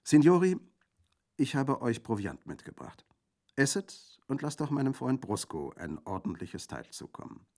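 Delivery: high, merry call to the guests; cheerful. A narrator's reading. Girl offering provisions to the men, cheerful. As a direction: announce it gaily, starting loud. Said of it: "sagte das junge Mädchen frohgelaunt".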